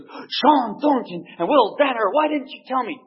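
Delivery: high, thrilled outburst; suddenly delighted at the news; excited old priest. Retold or imitated. Old priest learning who fights, high thrilled surprise. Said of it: "And the priest goes".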